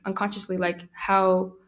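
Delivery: ordinary talk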